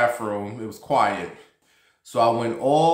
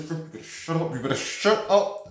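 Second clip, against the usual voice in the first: higher-pitched panicked warning; urgent hush; 5 semitones higher than usual. Retold or imitated. Embarrassed teen on the toilet hushing Frankie, high panicky warning. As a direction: hush him before anyone hears. Hissing at friend from stall.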